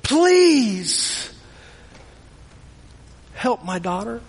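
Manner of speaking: pleading. Acted. Desperate mother pleading before Jesus, fading plea. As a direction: beg with everything left. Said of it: "pleading before him".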